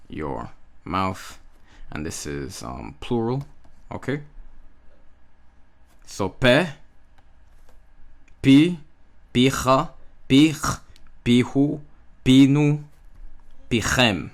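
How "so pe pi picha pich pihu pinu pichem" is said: each word pe, pi, picha louder and higher; louder teaching drill of the forms; Hebrew drill. Shown as a demonstration. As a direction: recite the forms crisply, one by one. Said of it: "notice how the accent is different".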